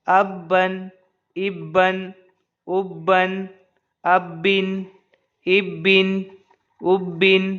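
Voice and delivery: measured recitation of isolated words; one word at a time, even pitch, pause between; drill without spelling. Shown as a demonstration. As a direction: press each doubled consonant, then let the vowel out. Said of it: "अब बिना हिज्जा के पढ़ दे रहे हैं".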